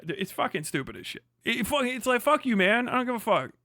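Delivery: normal talking voice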